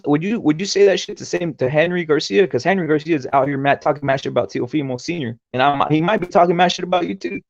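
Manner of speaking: normal talking voice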